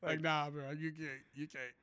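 low, quiet, unimpressed brush-off; deadpan, dropped-down no; deadpan refusal. Acted. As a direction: turn the buyer down softly and low. Acted refusal to sell Greenland.

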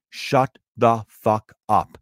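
slow, each word set apart; emphatic retort. Retold or imitated. Comic shutting down a heckler, slowly emphatic. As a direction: space out every word of the command.

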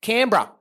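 "Canberra" clipped to "CAN-bra"; local pronunciation. Shown as a demonstration. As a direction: swallow the middle of "Canberra": "Canbra". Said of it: "It's pronounced".

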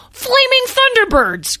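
very high, loud, drawn-out exclamation; excited cry. Acted. Lyle cries out at Smudgy's arrival, wildly excited. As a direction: cry it out slowly in awe at full volume. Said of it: "cried Lyle".

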